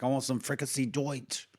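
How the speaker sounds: lightly put-on German accent; mock German accent. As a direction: play at a comic German accent. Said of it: "is that your German accent?".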